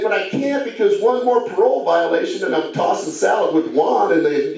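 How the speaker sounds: usual speaking voice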